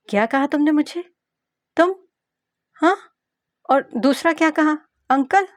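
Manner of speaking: offended outrage. Acted. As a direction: bristle at being called uncle. Ruhel offended at being called uncle. (Uncle कहे जाने पर भड़का हुआ लड़का)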